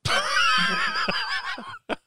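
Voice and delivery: big high shout of laughter. Real, not acted. Burst of laughter.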